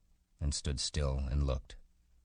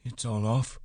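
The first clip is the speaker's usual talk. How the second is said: sulky; 7 semitones higher than usual. A narrator's reading. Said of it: "Candy asked sulkily".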